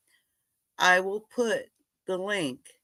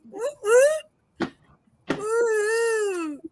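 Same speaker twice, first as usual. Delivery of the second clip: very high woo-hoo; long, high celebratory whoop; happy whoop; much slower than usual. Real, not acted. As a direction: cheer in a high hoot. Cheering brother's arrival.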